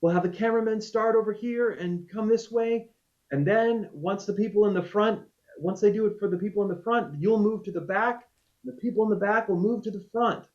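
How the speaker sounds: high, projected crowd-directing voice; calling out instructions. Retold or imitated. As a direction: re-enact marshalling a big crowd from the middle. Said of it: "and I said".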